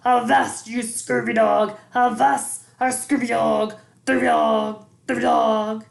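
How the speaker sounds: loud, gruff toy pirate call; egg-shaped toy pirate bellows at the mom; bellowing pirate. A narrator's reading. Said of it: "pirate that bellowed".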